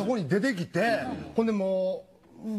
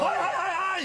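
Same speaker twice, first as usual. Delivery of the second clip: eager hand-raising; 8 semitones higher, much faster than usual. Retold or imitated.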